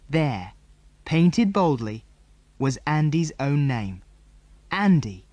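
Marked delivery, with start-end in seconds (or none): none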